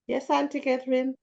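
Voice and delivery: ordinary talk, nothing marked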